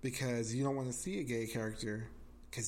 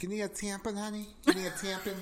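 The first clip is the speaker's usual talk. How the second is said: higher-pitched Wisconsin mom, helpful offer; Wisconsin accent; 6 semitones higher than usual. Retold or imitated. Retelling the movie woman's offer, Wisconsin accent. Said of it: "run into this Wisconsin family".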